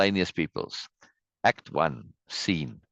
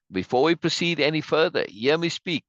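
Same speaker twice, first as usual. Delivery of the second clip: higher-pitched theatrical call to a crowd; raised-pitch stage oratory; rallying citizen; 7 semitones higher than usual. Acted. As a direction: read it out like a rebel addressing followers. Reads citizen's line as rallying speech.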